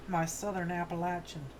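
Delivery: ordinary talk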